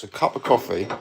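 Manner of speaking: ordinary talk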